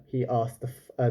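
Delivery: ordinary delivery, nothing marked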